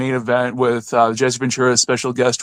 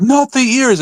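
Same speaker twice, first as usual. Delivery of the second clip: wrestler's plea; 10 semitones higher, 3 dB louder than usual. Retold or imitated. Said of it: "he'd be like".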